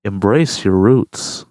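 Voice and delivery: parroting the friend's pun in a slow, low mocking voice; mocking drawl. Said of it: "Don't say it like that".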